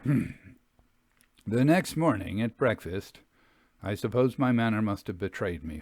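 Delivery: plain reading voice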